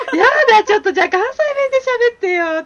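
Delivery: high, loud, laughing plea; delighted teasing. Retold or imitated. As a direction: squeal an eager request, fast and amused. Retells teasing request to hidden Kansai speaker. (大はしゃぎで笑いながらねだる)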